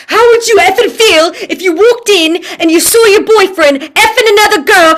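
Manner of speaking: loud, high mock British coach yelling; a hammed-up British coach shouting. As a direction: bark it like a pushy British acting coach. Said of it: "what he basically says".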